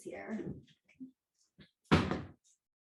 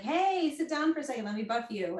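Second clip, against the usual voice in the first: starts high and loud on hey, then settles; eager invitation; 5 semitones higher, 3 dB louder than usual. Retold or imitated. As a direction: pounce on a house guest with glee. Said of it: "I'm like".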